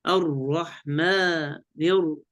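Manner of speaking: slow الرحمن with a firmly doubled ر; full shaddah. Shown as a demonstration. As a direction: lean on the doubled 'r' in الرحمن, vowels drawn out. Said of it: "تجديد كامل، لم يبين هذا". (تشديد واضح لراء الرحمن ببطء)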